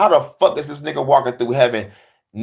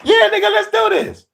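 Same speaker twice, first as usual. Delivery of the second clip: 1 squeaky eager Lucifer; 3 dB louder than usual. Acted. Lucifer accepting the bet.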